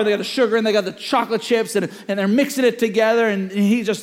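usual speaking voice

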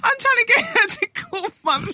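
high-pitched exasperated complaint; comically wound-up rant; comic exasperation. Real, not acted. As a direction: protest in a high, worked-up voice. Comic rant about Siri not calling.

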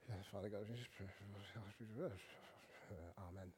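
very low, barely audible mumbled prayer; hushed, self-effacing mock prayer; mock timid prayer. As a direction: imitate reserved English people praying under their breath. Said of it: "us English people just go".

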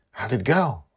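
usual speaking voice

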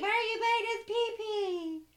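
hammed-up nanny voice; mock scolding. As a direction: tell off the biter like a child. Mock scolding the biting player.

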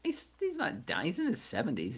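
as if that's absurd; incredulous. Real, not acted. Disbelief at friend's grim prediction.

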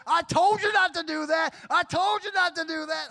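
high, loud I-told-you-so; mock scolding wife, high and loud. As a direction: nag him like his mom. Wife laughing, scolding him.

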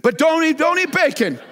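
high, loud mock-pleading voice. Shown as a demonstration. Preacher mocking a bacon refuser, pleading. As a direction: beg the listener in a put-on voice. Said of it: "Can't understand that".

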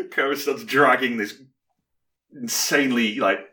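ordinary talk, nothing marked

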